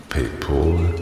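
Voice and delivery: "PayPal" tried with a rounded "-pol" ending; trying pronunciations. Shown as a demonstration. Call-taker unsure how the name is pronounced, trying "PayPal" as "pay-poll". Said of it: "wie man es richtig ausspricht".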